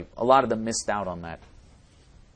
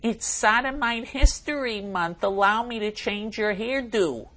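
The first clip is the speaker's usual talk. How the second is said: higher, slower hammed-up mocking voice; mock camp voice; 11 semitones higher, 4 dB louder, slower than usual. Host reads out a listener's request in a hammed-up higher mocking voice. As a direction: ham it up, much higher and slow. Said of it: "Please say this in a homo accent".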